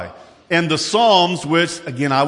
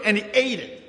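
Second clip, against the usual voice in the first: ordinary delivery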